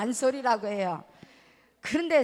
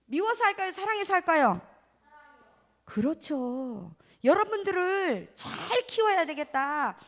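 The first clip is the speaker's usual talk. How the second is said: ordinary talk, nothing marked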